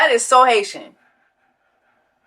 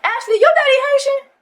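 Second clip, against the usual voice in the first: surprised; 11 semitones higher, faster than usual. Real, not acted. Realises the father is Haitian.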